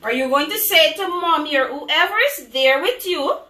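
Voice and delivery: ordinary delivery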